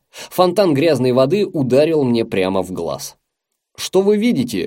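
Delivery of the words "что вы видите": higher-pitched, half-raised calling voice; understated shout to the next room; mild call out. A narrator's reading. Repairman calling from the bathroom, mild shout. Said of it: "крикнул он из ванной".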